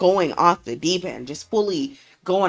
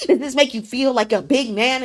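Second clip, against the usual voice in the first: laughing, higher, louder mockery; taunting through laughter; 8 semitones higher, 5 dB louder than usual. Wife taunts the boss while laughing. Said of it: "she's laughing in his face".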